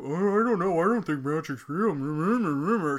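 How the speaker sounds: loud, high mock dithering; mocking character imitation. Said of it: "have characters go".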